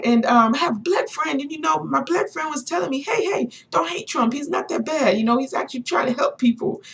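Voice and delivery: high-pitched excited retelling; imitating passionate man. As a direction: act out his eager story. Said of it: "he was so passionate about what he was talking about".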